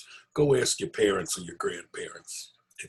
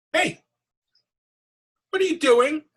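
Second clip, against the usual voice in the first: much higher, louder squeaky goblin voice; tiny goblin; 8 dB louder than usual. Acted. Tiny goblin catches Herschel lighting candles, high squeaky. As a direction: squeak at him like a horsefly-sized goblin.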